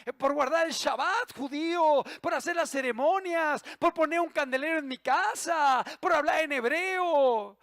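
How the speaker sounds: normal talking voice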